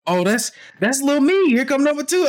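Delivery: retold high-pitched excitement; delighted new dad. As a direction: marvel at your newborn. Retold joy at twins' birth.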